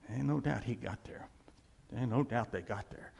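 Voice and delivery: a sudden hushed whisper; preacher to congregation, whispering an aside. Real, not acted. Whispered aside.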